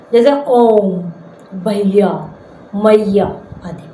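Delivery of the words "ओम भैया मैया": ओम, भैया, मैया slowly, each with a long held vowel; triple-length vowels. Shown as a demonstration. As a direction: stretch ओ in ओम and the final आ in भैया, मैया. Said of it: "तीन मात्रा का समय लगता है". (ओम, भैया, मैया में स्वर को खूब खींचकर)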